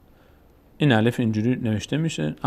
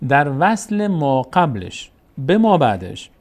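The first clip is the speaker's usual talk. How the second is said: در وصل ما قبلش به ما بعدش drawn out slowly, phrase by phrase; slow teacher's emphasis on وصل ما قبلش and ما بعدش; slow emphatic repetition; 3 semitones higher, 4 dB louder, much slower than usual. Shown as a demonstration. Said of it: "یک بار دیگه دقت کنید عزیزان".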